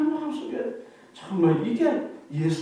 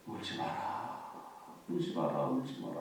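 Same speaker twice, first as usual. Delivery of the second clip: tender comfort; 13 semitones lower, 16 dB quieter than usual. Acted. Jesus comforting the grieving widow.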